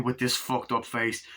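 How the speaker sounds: normal talking voice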